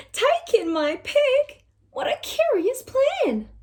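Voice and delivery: put-on a baffled old woman questioning; puzzled old lady. Lady questions the wise old man's plan, high and puzzled.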